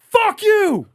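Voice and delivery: high, loud festival greeting yell. Retold or imitated. Demonstrating how Wasteland Weekend says hello, yelled greeting. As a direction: holler it out like a rowdy hello. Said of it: "like, how you say hello".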